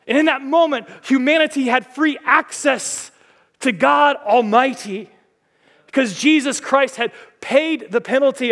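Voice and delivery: high, loud, soaring sermon voice; fiery preaching. Acted. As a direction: proclaim it to the whole church. Preaching at full force.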